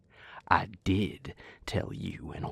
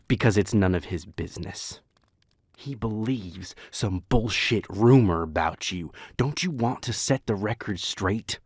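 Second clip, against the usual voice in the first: louder, heated retort; raised voice; 10 dB louder than usual. Acted. Arguing loudly about a bullshit rumor, raised voice. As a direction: snap back loudly.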